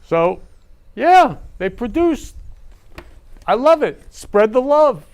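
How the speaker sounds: high, bright reveal of a name; excited reveal. Real, not acted. Host revealing the daughter's photo, high and thrilled. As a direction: announce her like a star.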